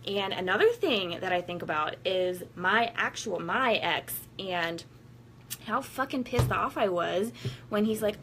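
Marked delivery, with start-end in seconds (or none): none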